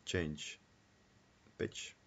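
usual speaking voice

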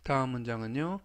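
ordinary delivery

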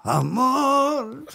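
very high, sighing "amor". Acted. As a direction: coo it up high like a diva straining. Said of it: "[name], ¿cómo le imitarías".

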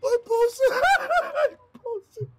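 high squeaky words through laughter; laughing to tears. Real, not acted. Streamer gasping through laughter about posting the clip. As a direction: wheeze it out between laughs.

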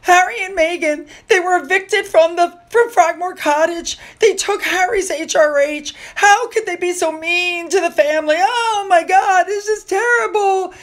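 mock-tearful high complaint; mocking whiny critics. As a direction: whine it like an overwrought fan. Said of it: "everybody's going on about".

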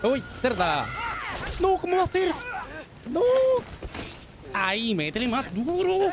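real panic in a jump scare; panicked screaming. Real, not acted. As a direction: scream while being chased. Frantic escape from attacking enemy.

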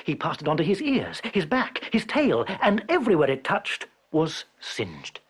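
ordinary delivery, nothing marked

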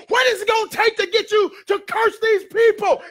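high, loud exasperated outburst; exasperated, upset. Retold or imitated. Upset king confronts the seer who won't curse, exasperated. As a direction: play a king at the end of his patience. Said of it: "he's exasperated. He's really upset".

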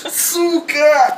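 laughing. Real, not acted. Burning nose, laughing.

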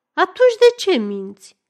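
high, loud challenge; angry accusation. Acted. Man pressing woman about the jacket, angry accusation. Acted interrogation.

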